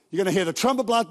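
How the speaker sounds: ordinary talk, nothing marked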